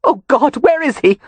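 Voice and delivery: high, loud anguished plea; the fear breaks through; panicked cry. A narrator's reading. Amelia hearing her husband is poisoned, panicked cry. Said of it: "A strangled cry escaped her".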